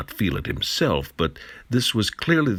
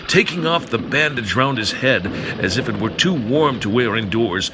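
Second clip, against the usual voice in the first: ordinary delivery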